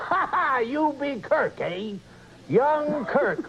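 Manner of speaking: hammed-up old sea-dog, high and slow; pirate voice. Engineer taunts young Kirk, pirate voice. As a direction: crow slowly like a comic pirate. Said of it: "Or you have".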